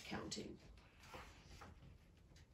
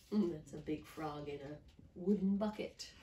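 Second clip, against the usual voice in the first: usual speaking voice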